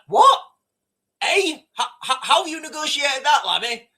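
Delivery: high, loud, laughing "What? Eh?"; shocked disbelief. Pretend. A citizen hearing that Birmingham went to Russia, shocked. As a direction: gasp in disbelief, then laugh it out. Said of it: "And you're like".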